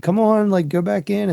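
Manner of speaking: high sing-song coaxing to chickens; coaxing call. Retold or imitated. Herding chickens, coaxing them back in. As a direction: shoo them gently back home.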